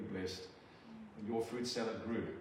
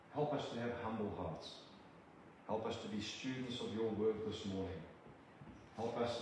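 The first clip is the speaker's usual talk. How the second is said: measured, reverent prayer to God; earnest prayer; 4 dB quieter than usual. Acted. Preacher praying over the church family, earnest prayer. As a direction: pray it humbly over the congregation.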